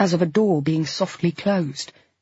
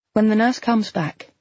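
plain reading voice

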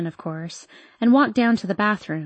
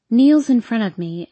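ordinary delivery

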